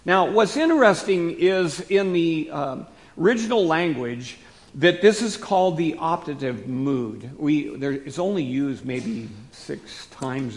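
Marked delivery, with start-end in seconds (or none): none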